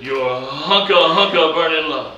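drawn-out, loud [name] delivery; [name] catchphrase. Pretend. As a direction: belt the hunka vow slowly like [name].

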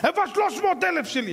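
high insistent creditor; demanding creditor. Acted. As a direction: demand the money back.

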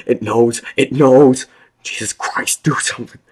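loud, high, panicked cries; sobbing man begging in terror. A narrator's reading. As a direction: scream it in terror. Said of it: "said with terror".

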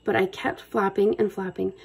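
usual speaking voice

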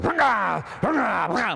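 high roaring rev noise; imitated engine roar. Car starts up with no muffler, imitated roar. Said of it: "the thing goes".

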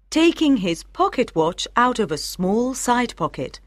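ordinary delivery, nothing marked

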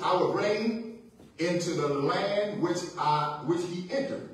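measured scripture, spaced phrase by phrase; real slow reading. Real, not acted. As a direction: read slowly, letting each phrase settle. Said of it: "I'm gonna read it real slow".

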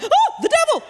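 high-pitched superstitious shriek, hammed up; mock terror. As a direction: gasp and cry out in mock horror.

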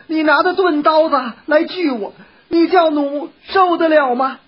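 high, wailing plea of a frightened wife; tearful pleading. A narrator's reading. Kneeling wife begging her husband for an explanation, tearful. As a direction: plead as if crying, pitched high throughout. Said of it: "就跪在地上哭道".

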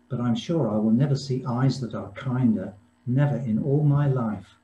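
ordinary talk

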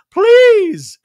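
very high, very loud mock plea; Dorit. Dorit answers Anna Marie chiming in, loud high mock plea. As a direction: blurt it in a hammed-up high voice. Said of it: "And Dorit goes".

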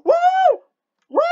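loud high cheering whoops; excited whoops. Real, not acted. Gamer cheering at the screen, loud whoop. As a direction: whoop with joy.

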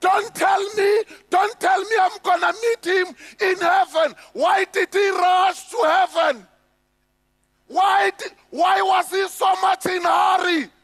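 imitating a furious grieving widow; angry widow. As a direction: bite back at the preacher, outraged. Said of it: "she will look at me and say".

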